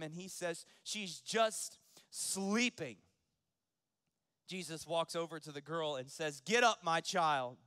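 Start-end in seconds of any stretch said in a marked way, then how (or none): 0.8-3.0 "just" and "sleeping" drawn out loud, with a gap between; emphatic declaration. Acted. Jesus proclaims to the mockers. 6.4-7.6 slow, booming preacher's command; forceful raised voice, unhurried; loud command. Acted. Jesus commands the dead girl.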